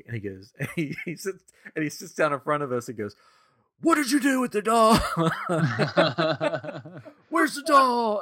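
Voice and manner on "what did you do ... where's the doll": put-on higher, louder young boy grilling a suspect, laughing; kid playing interrogator. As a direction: play the little cop demanding answers. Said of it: "We gotta interrogate".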